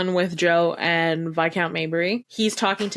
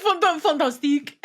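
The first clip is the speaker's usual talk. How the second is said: laughing, higher mock French fantastique; 8 semitones higher than usual. Echoing her friend's French scribe, laughing in a French accent. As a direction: giggle through a French fantastique.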